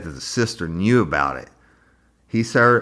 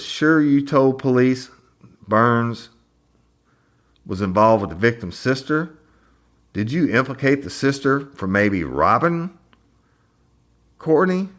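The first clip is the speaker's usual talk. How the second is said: mock prosecutor; 6 semitones higher than usual. Prosecutor cross-examining, retold.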